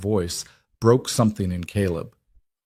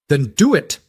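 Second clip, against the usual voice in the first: higher and louder defiant shout; 6 semitones higher, 5 dB louder than usual. Acted. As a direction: throw the dare back at him. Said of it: "Caleb shouted".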